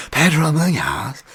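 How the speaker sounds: high, drawn-out bad English accent; terrible Bisping. Podcaster mimicking a commentator calling fights, badly faked English accent. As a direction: call the name high and slow in a hammed-up English accent. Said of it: "Bisping. I know it's terrible Bisping".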